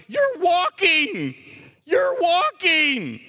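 high, slow, marvelling You're walking; mock amazed cry. Preacher imagining the crowd's delight, amazed. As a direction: cry it out in wonder, drawn out. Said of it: "and you're not going".

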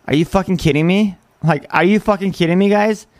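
ordinary talk

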